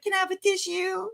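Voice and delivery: high, soft whimpering request; fake-cry the request; mock fake crying. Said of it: "and she was like".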